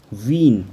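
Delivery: "वीन" with a long German ee vowel; German pronunciation. Shown as a demonstration. Teacher models the German word for students, clearly. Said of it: "as in wien?".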